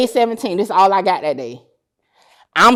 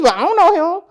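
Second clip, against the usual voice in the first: ordinary talk, nothing marked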